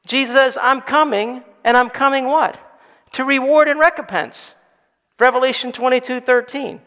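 loud, high, full-force preaching; impassioned preaching voice; fiery preaching. Acted. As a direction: drive it home to the congregation.

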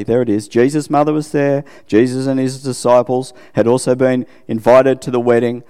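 ordinary talk

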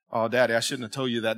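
soft, low imitation of his daughter; regretful daughter. Daughter confessing to Daddy in the truck, regretfully imitated. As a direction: drop to a small, sorry voice.

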